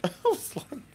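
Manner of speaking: high laugh into disbelief; giggling. Real, not acted. Daughter laughing at mom, giggling disbelief. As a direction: giggle then ask in disbelief. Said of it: "And I just giggled".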